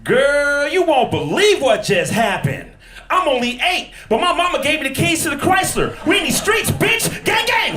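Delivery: put-on high, hyped girlish bravado; little girl acting gangster; street little girl. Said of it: "That commercial should go like this".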